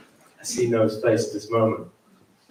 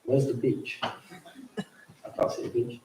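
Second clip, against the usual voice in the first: inner thought; 10 dB quieter, slower than usual. Retold or imitated. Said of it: "I'm thinking".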